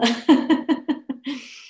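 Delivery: big demonstrated laugh in a steady run; hearty put-on laughter. Shown as a demonstration. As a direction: keep the big exercise laugh rolling. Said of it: "big laughter".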